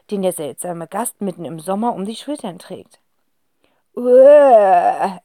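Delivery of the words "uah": loud startled Uah; startled gasp. Acted. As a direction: gasp in shock. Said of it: "entfährt es den Zwillingen". (erschrockenes Aufstöhnen)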